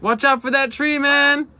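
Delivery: high, loud joking yell; loud warning. Retold or imitated. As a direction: call out the warning. Echoes clip warning loudly.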